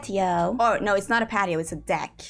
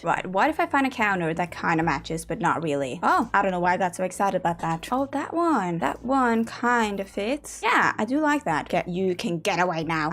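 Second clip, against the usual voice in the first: ordinary talk, nothing marked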